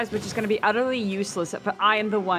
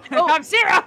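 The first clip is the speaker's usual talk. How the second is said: laughing exclamation; 9 semitones higher, 6 dB louder than usual. Real, not acted. No healing left, laughing.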